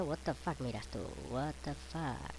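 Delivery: ordinary delivery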